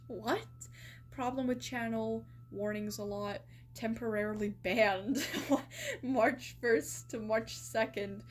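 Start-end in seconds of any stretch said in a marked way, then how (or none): 5.3-6.0 a squeaky burst of laughter; sudden high laugh. Real, not acted. Bursts out at the ban dates.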